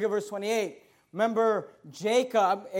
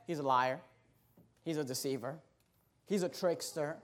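dropped low and much softer; low scornful verdict; 7 semitones lower, 10 dB quieter than usual. Retold or imitated. Neighbours quietly condemn Jacob as a liar. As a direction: murmur it like gossip behind his back.

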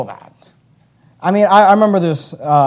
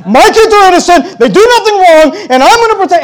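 higher, louder mocking parent voice; mock defensive parent; 11 semitones higher, 9 dB louder than usual. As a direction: parody the parent who defends their kids.

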